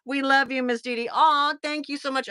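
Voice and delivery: ordinary delivery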